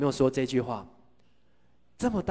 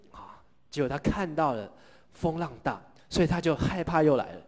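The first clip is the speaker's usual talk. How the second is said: ordinary talk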